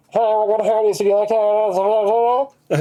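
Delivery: high, slow, put-on cashier greeting; hammed-up imitation of the cashier; drive-thru worker voice. Retelling the drive-thru order to friends, mock cashier voice. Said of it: "They go".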